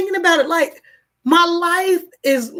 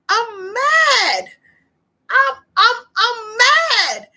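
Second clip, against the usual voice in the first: higher, tearful angry outburst; mad; 7 semitones higher, much slower than usual. Real, not acted. Venting to viewers about the company, tearful and angry. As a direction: let the anger crack through into tears. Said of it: "I'm pissed off and I'm mad".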